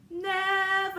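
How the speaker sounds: high, full-voiced holler; very loud, ringing call. A narrator's reading. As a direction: belt the name out to be heard. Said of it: "he shouted again, even louder".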